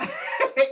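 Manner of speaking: high, loud burst of laughter; laughing. Real, not acted. Host cracking up at a guest's remark, laughing.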